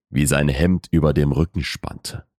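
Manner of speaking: plain reading voice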